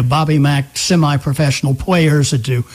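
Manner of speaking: normal talking voice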